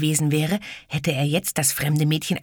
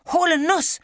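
scolding; 4 dB louder than usual. Acted. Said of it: "schimpfte es".

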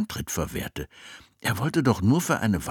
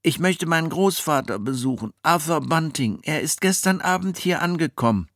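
put-on boy voice; 9 semitones higher, 3 dB louder than usual. Jack speaks.

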